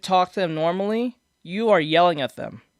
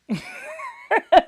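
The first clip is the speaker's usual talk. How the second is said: short laugh; 10 semitones higher than usual. Real, not acted. Laughs at own joke.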